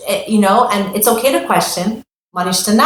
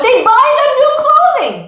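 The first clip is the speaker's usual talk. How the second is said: amazed emphasis; 5 dB louder than usual. A narrator's reading. Parent's surprising generosity.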